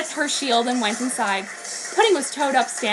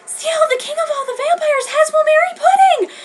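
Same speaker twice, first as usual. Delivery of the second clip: put-on very high, louder urgent girl's voice; 6 dB louder than usual. Lettuce tells Rio about Pudding in a very high voice. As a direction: blurt it out in alarm.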